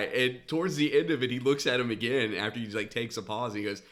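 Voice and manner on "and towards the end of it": ordinary delivery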